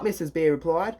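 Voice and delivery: usual speaking voice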